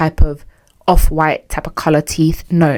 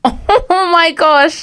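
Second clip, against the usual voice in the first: mock horror; 11 semitones higher, much slower than usual.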